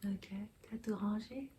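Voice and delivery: whispered question to Diego, barely voiced. Real, not acted. Mother whispering to Diego off to the side, whispered. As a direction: breathe it so the camera barely catches it. Checking quietly on Diego while filming.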